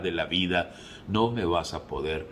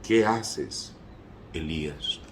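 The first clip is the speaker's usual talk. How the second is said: gentle voice of God; slower than usual. Acted. God speaking to Elijah at the cave, gentle voice. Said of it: "llegó una voz a él diciendo".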